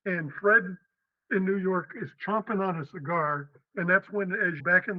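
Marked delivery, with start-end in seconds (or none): none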